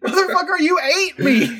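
high, loud mock-furious yell; hammed-up betrayed fury through laughter; mock outrage. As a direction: cannibal yelling at the man they ate, mock fury. Acting out the angry cannibals.

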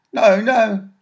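a scared urchin begging; frightened boy. Acted. Caught boy pleading with Mary, high and scared. Boy looks round wildly.

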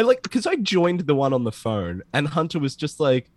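ordinary talk

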